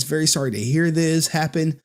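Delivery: ordinary delivery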